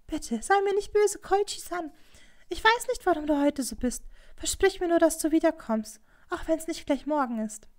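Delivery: high, almost whimpering plea; imploring whine, pitched high; pleading. A narrator's reading. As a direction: beg him not to be angry. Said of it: "mit flehentlichem, fast winselndem Ton".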